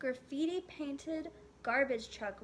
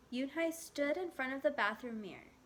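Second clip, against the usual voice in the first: ordinary delivery, nothing marked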